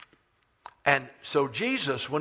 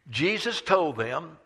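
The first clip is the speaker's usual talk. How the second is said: normal talking voice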